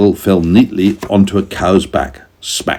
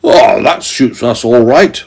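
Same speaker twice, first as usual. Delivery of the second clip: farmer, mild cheer; 4 semitones higher, 3 dB louder than usual. A narrator's reading. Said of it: "cried the farmer".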